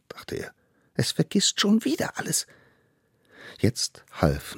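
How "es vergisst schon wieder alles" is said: high, eager little voice; tiny eager hunter. Acted. Little hunter brings food to the mammoth, high and eager. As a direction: play the smallest one, proud and keen. Allerkleinste frustrated.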